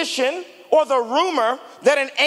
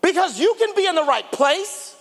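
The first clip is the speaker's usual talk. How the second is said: ordinary delivery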